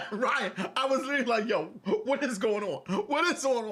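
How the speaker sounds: high retold confusion through laughter; laughing bewilderment. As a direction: laugh while acting out being lost.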